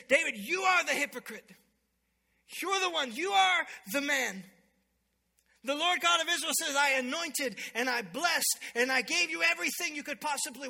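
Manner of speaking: acted prophet, ringing and emphatic; forceful accusation. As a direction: confront a king with his guilt, full force. Prophet confronts the king, acted.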